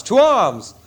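the second call carries further off; echoing battle cry. Acted. Repeated call to fight.

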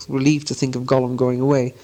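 ordinary delivery, nothing marked

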